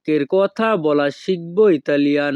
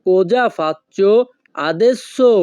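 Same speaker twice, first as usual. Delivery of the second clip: very slow, split in two; slow teaching demo; 2 semitones higher, much slower than usual. Shown as a demonstration. Teacher to viewers, slow clear model phrase. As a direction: model it slowly for repeating. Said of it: "তখন আমাদেরকে বলতে হবে".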